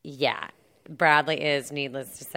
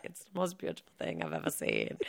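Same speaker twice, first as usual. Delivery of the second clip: awed hush; 11 semitones lower, 10 dB quieter, faster than usual. Retold or imitated. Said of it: "and he's like".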